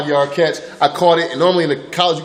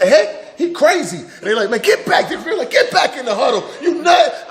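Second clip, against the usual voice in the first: teammates mocking; 11 semitones higher than usual. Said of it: "everybody's like".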